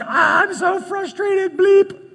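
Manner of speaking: high, loud, slow mock outburst of frustration; frustrated outburst. Husband cursing at his wife over getting lost, hammed-up outburst. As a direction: let it burst out, strained and loud. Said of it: "and I'm like".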